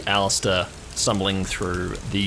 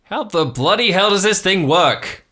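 put-on high, loud, befuddled old-uncle bluster; exasperated uncle shouting at a gadget; Uncle Marv; 11 semitones higher, 8 dB louder than usual. Uncle fumbling with the phone on a call, loud and exasperated. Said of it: "you hear the unmistakable voice of".

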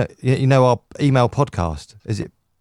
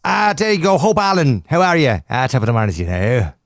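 put-on Irish accent. Co-host pretending to welcome Hope next week, Irish accent. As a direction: greet the guest in a mock Irish lilt. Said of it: "got an Irish accent".

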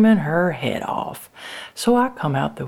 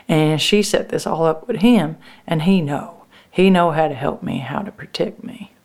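ordinary delivery

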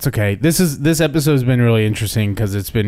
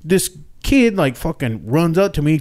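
ordinary delivery

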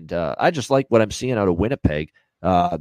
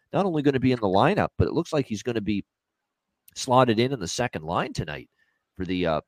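ordinary talk, nothing marked

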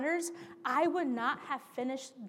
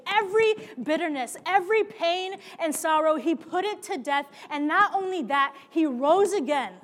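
rising, louder preaching list; preaching build; 4 semitones higher, 6 dB louder than usual. Acted. Preacher proclaiming to congregation, building louder. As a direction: build each item toward the peak.

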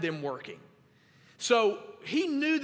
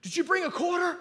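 put-on much higher, loud excited kid voice; eager little son; 10 semitones higher, 3 dB louder than usual. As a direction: call out to dad like a hopeful little boy. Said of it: "and he goes".

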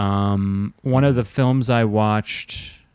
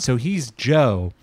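ordinary talk, nothing marked

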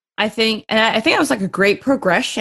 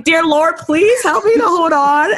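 mock desperate prayer; 10 semitones higher than usual. Mid-race desperate prayer retold.

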